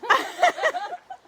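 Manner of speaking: loud high-pitched laugh. Real, not acted. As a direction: burst out laughing loudly. Laughing burst.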